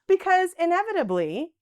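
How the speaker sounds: normal talking voice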